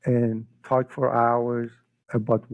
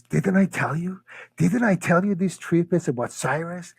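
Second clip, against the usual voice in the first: higher-pitched, delighted vindication; triumphant told-you-so; 6 semitones higher than usual. Retold or imitated. Group hearing the news back home, triumphant. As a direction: crow that you knew it. Everybody's triumphant reaction, retold.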